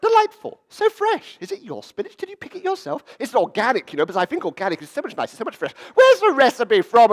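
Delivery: very high, loud mock delight; hammed-up high-pitched small-talk gushing; gushing dinner guest. Dinner guests going on about a spinach tart, gushing.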